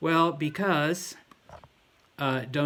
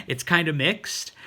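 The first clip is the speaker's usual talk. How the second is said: ordinary talk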